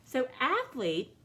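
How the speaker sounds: So athlete slowly, each syllable marked; slow syllable stress. Shown as a demonstration. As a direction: stretch athlete out for the class. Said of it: "has two syllables".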